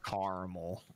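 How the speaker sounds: "caramel" as two syllables, CAR-mul; CAR-mul pronunciation. Shown as a demonstration. Offering friends a preference, "caramel" as CAR-mul. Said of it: "It sounds fancy".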